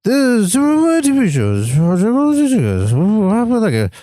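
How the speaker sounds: fast, slurred run of noise; quoting Superhuman. Retold or imitated. Quoting him for a doubtful friend, garbled impression. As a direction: rattle it off unintelligibly in a swooping put-on voice. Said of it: "but I'll quote him".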